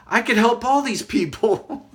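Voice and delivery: high, loud, eager announcement; excited outburst. Retold or imitated. To companion at the mall, excited outburst. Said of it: "I said it out loud".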